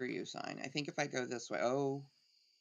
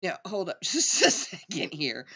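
loud laughing "hold up"; 3 semitones higher, 10 dB louder than usual. Real, not acted. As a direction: stop everything, cracking up. Playful halt while laughing.

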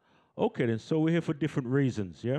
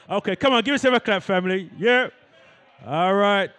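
loud, high, rousing cheer; hyping the crowd; 6 semitones higher, 9 dB louder than usual. Real, not acted. Host urges the audience to clap, loud hyping. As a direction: whip them up into applause.

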